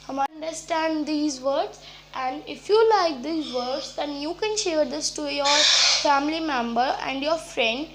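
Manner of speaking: ordinary talk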